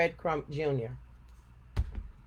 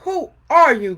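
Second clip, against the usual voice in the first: loud, higher demanding bark; growling Papa Bear; 9 semitones higher, 13 dB louder than usual. A narrator's reading. Papa Bear confronting the girl, loud growl. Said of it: "growled Papa Bear".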